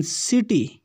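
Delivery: सिटी ending on "ee", not "ai". Shown as a demonstration. Teacher singles out the different ending, "ee" in सिटी. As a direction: end सिटी with an "ee" sound. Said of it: "सिटी में इसका उच्चारण different है".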